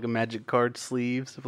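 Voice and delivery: normal talking voice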